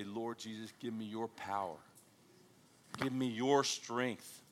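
soft, hushed plea; quiet prayer. Retold or imitated. Struggling believer asking the Lord for strength, hushed. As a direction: pray it softly, slowing at the end. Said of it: "we go and we say".

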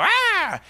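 high, loud shout to scare it; yell at owl. Retold or imitated. Pastor yelling up at the owl in the tree, loud high shout. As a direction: bark out a sudden scare cry. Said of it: "and I go".

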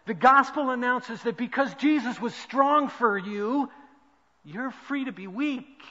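building from steady to loud, high preaching; rising to full pulpit force line by line; a preacher swelling toward a shout. Acted. Preaching a quoted passage.

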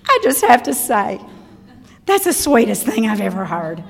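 lightly imitated tearful gushing; a touch of being moved to tears; mock tearful. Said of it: "says, with tears".

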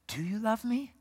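soft, slow, rising question. Acted. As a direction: ask it slowly and softly, lifting at the end. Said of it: "he says a little slower".

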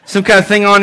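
normal talking voice

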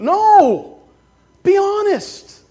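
loud high no, then slow be honest; emphatic no. Real, not acted. Preacher rebuking the congregation, loud and slow. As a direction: slam the no, then stretch the command.

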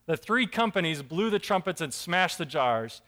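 ordinary talk, nothing marked

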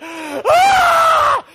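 very high, loud comic shriek; cartoonish terrified yelp; mock scream. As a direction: shriek out the hidden panic. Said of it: "But inside, like".

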